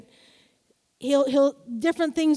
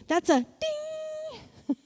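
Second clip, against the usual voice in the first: much higher, slow sing-song; playful high ding; 12 semitones higher, 3 dB quieter, much slower than usual. Acted. Preacher marking a pay attention moment, high and slow. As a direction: chime it like a bell.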